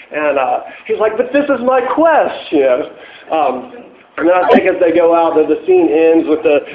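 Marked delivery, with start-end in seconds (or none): 1.2-2.5 higher, mock-wounded plea; a knight sulking over his lost quest; Galahad whining. Galahad pleading with the knights as they drag him out, mock-wounded.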